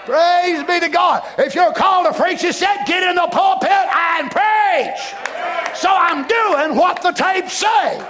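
slow, forceful preaching. Acted. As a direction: drive each phrase home slowly.

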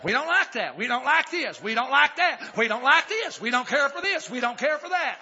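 mocking complainers. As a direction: chant each complaint with a swooping tune. Mimics complaining churchgoers in chanting repetition.

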